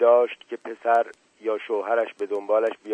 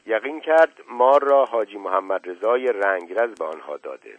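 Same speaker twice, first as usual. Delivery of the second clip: ordinary delivery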